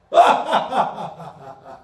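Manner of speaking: a loud, scoffing laugh; scornful laugh. Acted. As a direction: laugh them off with contempt. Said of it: "The Lord laughs".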